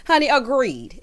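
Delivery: ordinary talk, nothing marked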